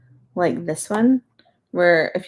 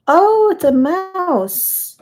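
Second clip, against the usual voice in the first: delighted surprise; 11 semitones higher, 7 dB louder, much slower than usual. Real, not acted. Spots a mouse in the puzzle.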